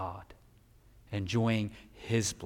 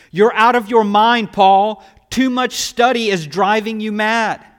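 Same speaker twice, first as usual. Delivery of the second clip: loud, high outburst reading Festus; loud exclamation; 9 semitones higher, 14 dB louder than usual. Acted. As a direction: cry it out as if he's lost his mind. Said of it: "Festus exclaimed in a loud voice".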